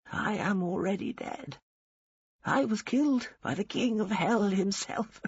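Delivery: put-on defiant old woman. A narrator's reading. Dying old woman defying the angel of death, dry and stubborn. Voices dying Che throughout.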